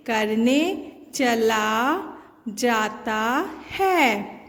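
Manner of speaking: very slow, drawn out; slow reading. Shown as a demonstration. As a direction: read it slowly for children. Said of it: "न में ए की मात्रा ने".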